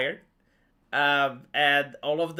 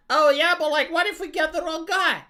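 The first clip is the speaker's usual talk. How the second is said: a higher put-on worried voice; mocking quote; 10 semitones higher than usual. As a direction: parody fretful people, pitched up. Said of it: "people this hyper concerned about".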